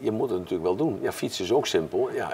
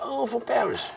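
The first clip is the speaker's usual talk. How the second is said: high mock American exclamation, much higher; slower than usual. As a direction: play the confused American host, pitched way up. Tony mishears, voiced by teller.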